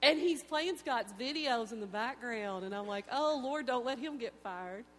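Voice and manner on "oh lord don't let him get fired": loud, pleading cry to the Lord; urgent prayer. Retold or imitated. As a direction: beg God out loud in a panic. Panicked prayer in retold moment.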